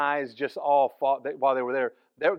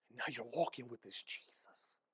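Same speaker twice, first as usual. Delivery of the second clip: hushed, awed whisper; a former fellow beggar whispering to the pastor; 3 semitones lower, 17 dB quieter than usual. Retold or imitated. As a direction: whisper it like a quiet realization. Beggar's quiet line.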